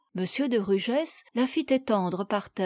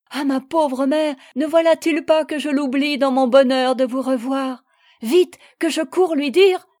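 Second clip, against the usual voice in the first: higher, louder rush of feeling; urgent exclamation; 3 semitones higher, 7 dB louder than usual. A narrator's reading. Daughter remembering her mother to her father, urgent. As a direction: burst out with sudden urgency.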